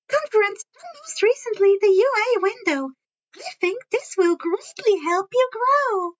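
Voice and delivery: high, chirpy principal voice with a sly edge; Principal Netsu, high and chirpy. A narrator's reading. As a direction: pitch it up like a cheerful little animal principal.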